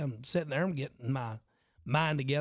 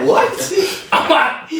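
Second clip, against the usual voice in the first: much higher and louder, cracking up; loud laughing outburst; 8 semitones higher, 15 dB louder, much slower than usual. Real, not acted. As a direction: burst out laughing mid-word. Laughing at his own story.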